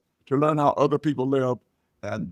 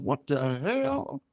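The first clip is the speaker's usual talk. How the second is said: slow, quiet, dragged-out shock; stunned disbelief; 5 dB quieter, much slower than usual. Retold or imitated. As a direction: let it fall out in slow disbelief. Father shocked at daughter.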